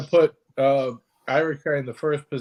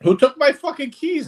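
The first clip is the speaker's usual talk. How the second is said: yelling across the room; 11 semitones higher than usual. Real, not acted. Realizes keys are gone, shouting.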